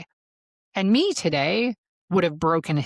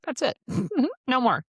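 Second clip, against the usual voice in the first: firm cutoff; 9 semitones higher than usual. Retold or imitated. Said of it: "I was like".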